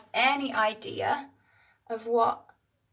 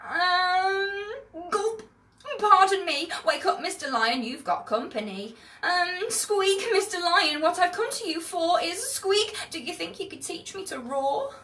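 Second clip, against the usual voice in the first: high, timid squeaky voice with gulps; a tiny mouse daring to speak to a lion; little mouse, higher and hesitant; nervous little mouse; 7 semitones higher than usual. Acted.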